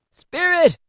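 high, loud cry with a broken voice. A narrator's reading. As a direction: voice breaking as he begs. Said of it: "said Scrooge in a broken voice".

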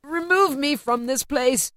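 slow, pleading, voice cracking; broken voice. A narrator's reading. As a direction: beg to be taken away, voice breaking. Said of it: "said Scrooge in a broken voice".

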